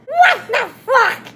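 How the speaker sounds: high loud shocked yell; shrieking what the; shouted. Retold or imitated. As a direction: scream it in disbelief. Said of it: "I shouted".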